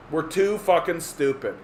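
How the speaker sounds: ordinary talk, nothing marked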